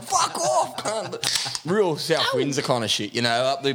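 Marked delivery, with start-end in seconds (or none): 0.0-1.6 high mock yell; retold yelling. Telling off the ex who trails him in the car, mock yell, pitched high. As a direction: fling it back at her in a mock yell. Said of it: "yelling through the window".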